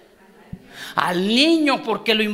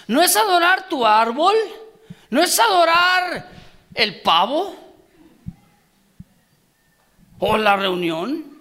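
loud, higher, rising preaching build; full-force preaching; 4 semitones higher, 5 dB louder, slower than usual. Acted. Preacher driving the point home to the congregation, loud preaching. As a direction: preach it louder with each line.

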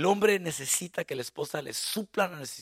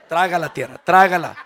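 louder, laughing plea; mock plea; 14 dB louder than usual. Pastor asking God to silence a woman, laughing. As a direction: wish the ground would swallow her. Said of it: "Y digo".

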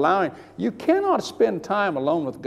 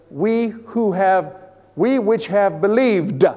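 slow, louder, solemn reading; scripture reading; 4 semitones higher, 4 dB louder, slower than usual. Acted. Preacher reading the Bible verse aloud, slow and solemn. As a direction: intone the verse slowly. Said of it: "The Bible says".

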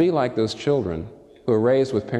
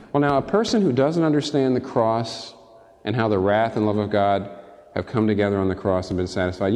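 normal talking voice

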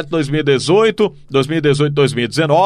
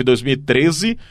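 ordinary talk

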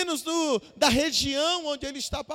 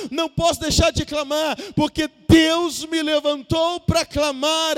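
loud, driving pulpit cadence building to a shouted climax; full-force preaching; 5 dB louder than usual. Real, not acted. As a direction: hammer out each repeated phrase to the congregation.

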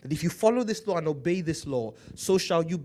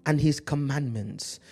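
scripture preaching; 5 dB louder, slower than usual. Acted.

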